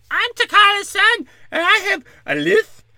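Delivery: hammed-up squeaky kid with a lisp; mocking T'Challa's son. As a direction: lampoon a lisping child confiding a secret. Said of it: "They're like".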